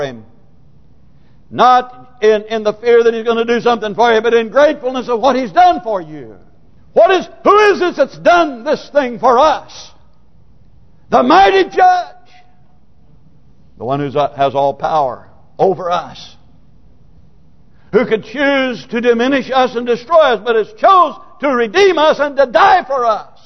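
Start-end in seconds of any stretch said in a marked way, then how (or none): 1.5-12.2 high, forceful pulpit delivery building to a cry; impassioned preaching. Acted. Preacher telling congregation of the mighty judge, fervent preaching. 17.9-23.4 high-pitched, fervent pulpit delivery; impassioned preaching. Acted. Preacher on Christ choosing to redeem us, impassioned preaching.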